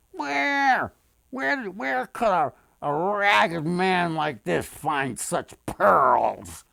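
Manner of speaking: high, doubting townsfolk voice; high, incredulous, then slowing; wondering townsfolk. A narrator's reading. Townsfolk doubt the ragged man's pearls, high and slow. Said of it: "they wondered".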